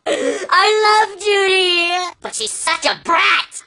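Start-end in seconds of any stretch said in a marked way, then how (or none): 0.1-1.9 swooning. Acted. Gasping crush confession.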